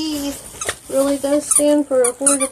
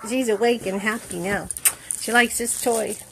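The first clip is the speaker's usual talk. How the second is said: ordinary talk, nothing marked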